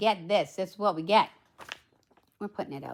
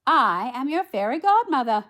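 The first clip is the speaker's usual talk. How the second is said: grand, high, loud storybook entrance; raised, proclaiming character voice; fairy godmother; 5 semitones higher, 9 dB louder than usual. A narrator's reading. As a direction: announce yourself as the fairy godmother. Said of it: "she said".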